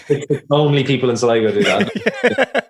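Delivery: high-pitched, talking through real laughter; laughing. Real, not acted. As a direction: squeeze the words out through laughter. Laughing along at Sligo joke.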